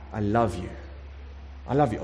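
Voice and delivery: low, gentle, unhurried answer; calm reassurance. Acted. God replying to a guilty believer, tenderly steady. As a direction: answer the outburst with quiet certainty.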